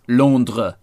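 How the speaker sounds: Londres with nasal first vowel and French r; French pronunciation. Shown as a demonstration. Telling co-host the French name, French pronunciation. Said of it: "For the French".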